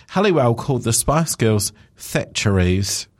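ordinary talk

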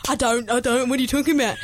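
mock denial in Kiwi accent; playful New Zealand accent; amped-up New Zealand accent. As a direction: deny it in thickened New Zealand vowels. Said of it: "I amp up my New Zealand accent".

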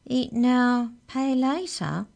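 high, puzzled repeat, slowing at the end; puzzled echo. A narrator's reading. Giant cook queries what credit means, puzzled. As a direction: echo it high as a baffled question.

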